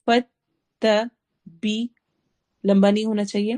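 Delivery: ordinary talk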